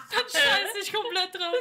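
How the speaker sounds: laughing exasperation. Real, not acted. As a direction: give up while cracking up.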